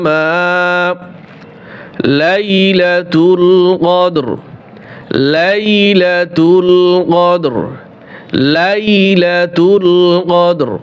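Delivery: slow, drawn-out tajweed chant; Quran recitation. Shown as a demonstration. Teacher reciting the verse to students, slow melodic chant. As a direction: sing the verse slowly with long vowels.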